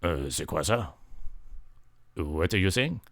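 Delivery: light gruff voice; low gruff reply. Acted. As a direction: grumble back. Hummer answers low.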